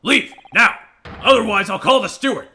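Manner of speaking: put-on loud, high shout as the old man; irate character bellow; angry threat. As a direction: order them out forcefully. Old man throws intruders out.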